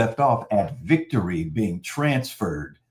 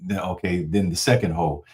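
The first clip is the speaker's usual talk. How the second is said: ordinary delivery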